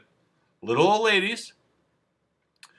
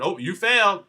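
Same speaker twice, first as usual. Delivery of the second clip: higher, louder mock gotcha; mock officer's verdict; 6 semitones higher, 5 dB louder than usual. Officer failing a driver on the nose test, mock triumphant. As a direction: pounce on the mistake as a mock officer. Mimics officer catching a mistake.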